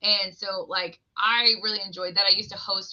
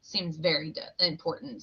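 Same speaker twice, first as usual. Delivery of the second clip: sarcastic aside; 4 semitones lower, 7 dB quieter than usual. Retold or imitated. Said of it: "I'm like".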